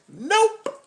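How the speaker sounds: high, sassy woman impression. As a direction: toss it high and careless. Woman voiced in dialogue.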